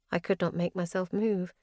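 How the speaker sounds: usual speaking voice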